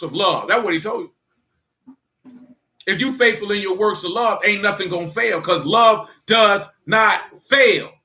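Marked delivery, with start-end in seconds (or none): 5.4-7.9 slow, each word weighted; preacher's slow clincher; very slow emphatic. Real, not acted. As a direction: lean hard on every word, slowly. Preacher driving point home.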